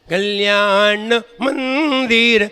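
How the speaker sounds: slow, drawn-out recited Sanskrit verse; slow verse recitation. Acted. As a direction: recite the verse line syllable by syllable. Verse reread.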